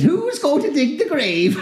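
high, slow gleeful demon voice; little demon. Acted. Little demon asking the boys, high and gleeful. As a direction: ask it like a delighted imp.

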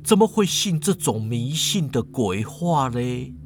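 low, slow character voice; low-pitched, doubtful professor; sceptical professor. Acted.

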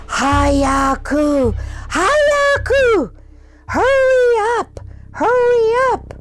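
loud, high shouted order; alarm shout. Retold or imitated. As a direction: bark it like an angry prison guard. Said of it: "the guard shouted".

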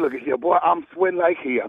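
normal talking voice

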